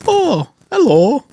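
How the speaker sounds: high, slow attempt at a Welsh accent. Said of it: "I can't even do a Welsh accent".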